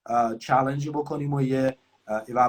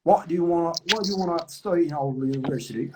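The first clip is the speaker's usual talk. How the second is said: British accent, words run together; rolling British English; 2 semitones higher than usual. Shown as a demonstration. Teacher imitating a British interviewer's rolling accent. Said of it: "انگلیسی ها یه ذره مثلاً میچرخونن".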